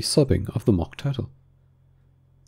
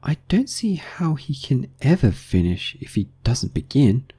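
higher, lightly voiced Alice; 6 semitones higher than usual. A narrator's reading. Alice puzzling to herself over the tale, higher character voice. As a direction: give Alice a higher, lighter voice than narration.

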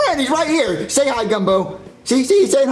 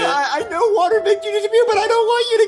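higher, tearful plea not to go; crying; 7 semitones higher, slower than usual. Acted. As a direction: sob it out over the dying friend. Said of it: "stop crying".

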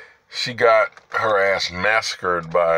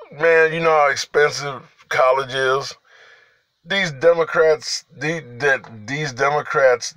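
rising indignant rant; exasperated; 6 semitones higher than usual. Real, not acted. Ranting, voice rising.

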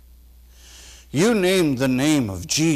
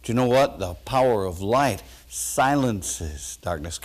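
normal talking voice

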